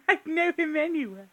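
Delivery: laughing Hatter-style voice; laughing character voice. Retold or imitated. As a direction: giggle the line out in character. Second take laughing, higher.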